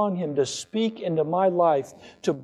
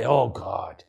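mock whining; 6 semitones lower than usual. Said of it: "Did any of you whine this week?".

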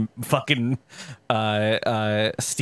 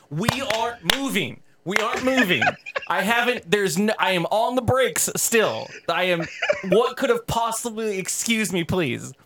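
flustered protest; 8 semitones higher than usual. Retold or imitated. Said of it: "And I was like".

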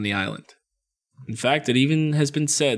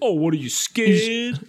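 higher, slow, laughing taunt; taunting teen boy; 8 semitones higher, slower than usual. Retold or imitated. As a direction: tease her for being chicken. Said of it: "He's like".

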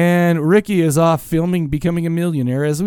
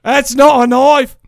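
slow, high, loud Australian Crocodile Dundee line; loud mock Australian Crocodile Dundee quote; Paul Hogan impression; 9 semitones higher, 5 dB louder, slower than usual. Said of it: "I've already become Paul Hogan".

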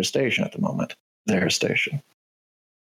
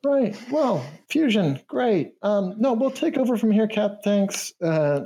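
canary voice; 11 semitones higher, slower than usual. Acted. Canary NPC, unimpressed, dismissing captain.

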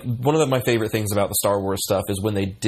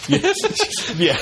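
laughing. Real, not acted. Laughing at joke about droids.

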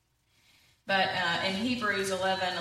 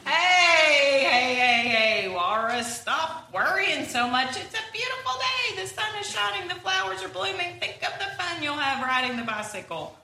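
cheerful Pig calling out; 7 semitones higher, 5 dB louder than usual. Acted. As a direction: snap him out of it, bright and loud. Pig interrupting Walrus's worry.

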